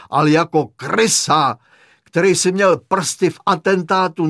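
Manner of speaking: loud tirade, rising in pitch; furious accusation. A narrator's reading. Hitler figure berating the patient he takes for Rommel, furious accusation.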